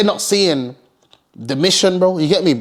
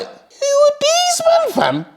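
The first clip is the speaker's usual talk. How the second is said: high, slow, drawn-out who are these men; confused stare; much slower than usual. Retold or imitated. Dimsy in the car staring at strangers, slow and high-pitched. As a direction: stretch it out high and baffled. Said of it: "Dimsy's like".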